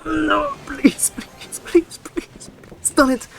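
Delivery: high, breathless begging under the breath; desperate pleading whisper. Real, not acted. Gamer begging his opponent's play to fail, frantic whisper. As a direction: whisper a panicked plea for the card not to land. Panicking over a game play.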